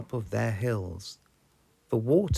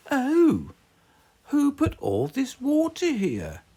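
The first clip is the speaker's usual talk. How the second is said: much higher, louder cartoon dad exclaiming; a flustered cartoon pig dad, pitched way up; Daddy Pig voice; 8 dB louder than usual. A narrator's reading. Daddy Pig swimming back to the house, higher and louder. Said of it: "Daddy Pig said as he swam".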